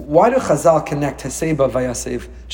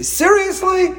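high, slow, drawn-out incredulous seriously; Shai's seriously; much slower than usual. Retold or imitated. Son Shai's signature word, high disbelieving mock. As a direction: mimic a teen's exasperated disbelief. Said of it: "As my son Shai would say".

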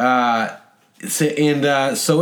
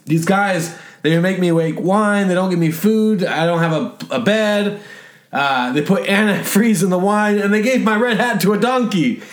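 put-on higher-pitched kid's tale of woe; complaining Bart; 6 semitones higher than usual. Bart reporting his captors to police, high and aggrieved. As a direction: rattle off grievances as a put-upon kid, pitched up. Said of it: "so Bart says these".

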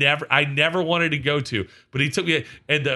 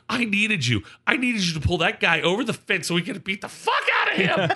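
higher, escalating mock shout; retold outrage; 6 semitones higher than usual. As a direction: plead indignantly with the absent backup. Scolding friend for missing fight.

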